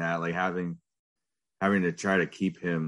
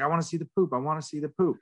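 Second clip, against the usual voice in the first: put-on demanding toddler; 8 semitones higher, faster than usual. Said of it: "And he's like".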